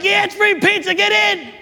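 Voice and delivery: high-pitched, eager call-in; acted eager welcome; excited invitation. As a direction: wave them in with a high, enthusiastic shout-out. Voices himself calling kids inside.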